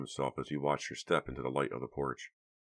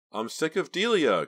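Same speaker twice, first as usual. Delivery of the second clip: much higher, louder outburst; cried out; 12 semitones higher, 8 dB louder than usual. A narrator's reading. Karina to Vincent, crying out in anger. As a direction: shout out your fury. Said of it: "Karina cried".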